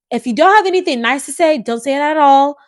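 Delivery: stern warning. Real, not acted.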